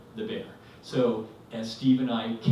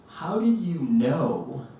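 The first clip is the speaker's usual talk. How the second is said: slow, loud and higher, KNOW drawn out; emphatic question; 5 semitones higher, 6 dB louder, much slower than usual. Retold or imitated. Husband questioning wife, slow emphatic disbelief. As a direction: lean hard and long on "know".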